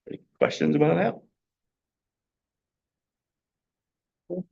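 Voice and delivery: losing voice, hoarse; strained, fading hoarse voice. Real, not acted. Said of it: "Losing my voice too".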